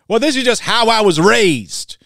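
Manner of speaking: hint of a defensive lady excusing herself; mock defensive woman. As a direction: play the defensive woman, lightly. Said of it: "woman's retort imagined".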